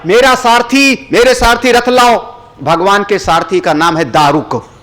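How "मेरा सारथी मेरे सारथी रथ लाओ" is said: high-pitched call to a charioteer; commanding summons. Acted. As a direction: summon your charioteer with raised voice. Acted god summons his charioteer. (ऊँचे स्वर में आदेश भरी पुकार)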